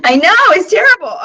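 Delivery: loud high laughing; laughing agreement. Real, not acted. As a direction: laugh it off, amused. Laughing at bad palette.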